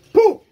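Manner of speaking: a sharp forceful puff of breath; loud ritual spit; ritual spitting puff. Real, not acted. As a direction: blow out hard like spitting on the spirit. Healer spits ritual breath.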